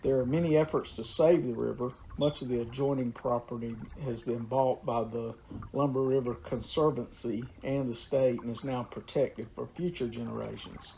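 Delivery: ordinary talk, nothing marked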